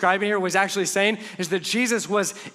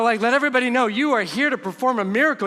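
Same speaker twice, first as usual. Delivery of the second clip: ordinary delivery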